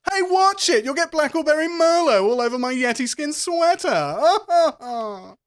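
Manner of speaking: high, loud haughty cry ending in laughter; obnoxious rich braggart. Acted. Rich athlete warning someone off his sweater, loud high braggart. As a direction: shout it high, then laugh at yourself. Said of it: "That kind of chat going on".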